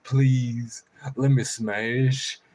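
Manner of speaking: drawn-out, begging crow voice; slow pleading crow. Retold or imitated. The crow answers him, slow and begging. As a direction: drag each word out like a begging bird. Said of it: "He's like".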